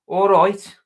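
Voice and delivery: loud, high mock Brummie greeting; Birmingham accent. Showing how Birmingham sounds, loud Brummie accent. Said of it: "in Birmingham, it's, uh".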